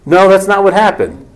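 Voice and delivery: ordinary talk, nothing marked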